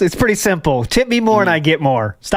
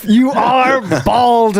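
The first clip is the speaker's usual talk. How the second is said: mock slow roast; 5 semitones higher, much slower than usual. Roasting back.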